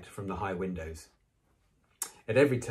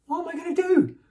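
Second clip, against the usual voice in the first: very high, loud panicky question; shrill, high-pitched alarm; 8 dB louder, faster than usual. A narrator's reading. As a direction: wail it shrilly. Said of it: "she asked shrilly".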